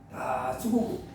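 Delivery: a tired, heavy murmur; weary low sigh. Acted. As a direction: drop low and soft, worn out. Sighing aside, very low. (지친 듯 낮게 읊조리듯)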